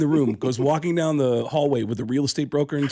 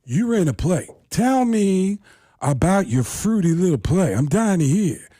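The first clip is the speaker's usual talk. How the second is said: louder, mocking Pacino sneer; Al Pacino impression; 4 semitones higher, 3 dB louder than usual. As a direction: sneer at his little play. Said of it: "And he goes".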